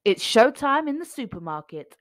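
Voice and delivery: ordinary delivery, nothing marked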